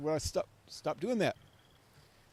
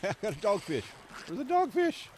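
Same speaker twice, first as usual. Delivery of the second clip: excited shout; 8 semitones higher, 8 dB louder than usual. Real, not acted. Catching a dogfish in game.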